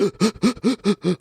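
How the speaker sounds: rapid panicky gasps, acted out; hyperventilating. Swimmer freaking out above water, mock hyperventilation. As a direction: imitate short, fast panicked breaths. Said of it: "like, hyperventilating".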